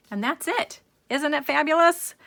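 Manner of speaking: high, loud, delighted show-off of the finished piece; excited reveal. Real, not acted. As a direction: lift it up and out, thrilled with the result. Proud reveal of the finished card.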